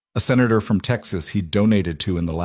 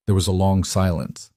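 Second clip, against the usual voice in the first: plain reading voice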